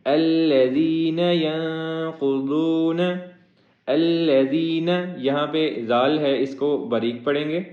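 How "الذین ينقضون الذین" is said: slow melodic Quran chant; chanted Quranic recitation, long and melodic; tajweed recitation. Shown as a demonstration. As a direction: draw each word out in sung recitation. Recitation of the verse.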